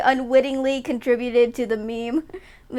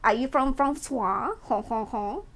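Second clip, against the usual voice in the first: hammed-up French caricature with cartoon chuckle; mock French accent; 5 dB quieter, slower than usual. Streamer imitating someone in a group call, mock French accent. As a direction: put on a silly French accent, stretching the "oh". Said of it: "And someone would ask".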